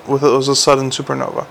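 plain reading voice

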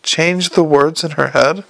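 usual speaking voice

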